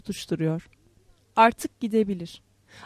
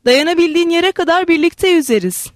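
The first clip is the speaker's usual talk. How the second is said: higher, effortful composure; raised, carefully controlled promise; trying to control voice; 6 semitones higher, 4 dB louder than usual. A narrator's reading. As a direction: hold the voice steady against emotion. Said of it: "Sesimi kontrol etmeye çalışarak".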